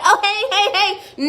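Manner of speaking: high-pitched, put-on cheery greeting; mock cheerful. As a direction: chirp it like a fake happy hello. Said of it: "feeling so cheerful to be like".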